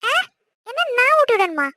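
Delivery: high, loud startled question; shocked wife; shocked disbelief. Acted. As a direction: gasp it out, caught off guard. Wife shocked at being asked to feed him.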